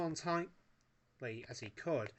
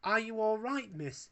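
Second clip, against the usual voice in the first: lightly voiced fussing Wallace, higher; 9 semitones higher, 4 dB louder than usual. A narrator's reading. Wallace fusses over the rescued Bakelite girl. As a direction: fuss over her gently. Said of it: "he fussed".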